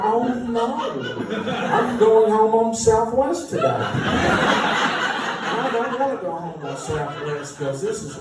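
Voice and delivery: high, strained panicky inner voice, slowed. Retold or imitated. Musician meeting [name], panicking inside. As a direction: squeak out the inner panic, half laughing. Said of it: "Inside I'm like".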